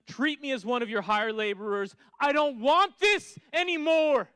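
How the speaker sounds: loud, rising preached plea, peaking at the end; fervent preaching. Acted. Preacher reading the prodigal son's resolve, building loud. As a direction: preach the son's confession with urgency.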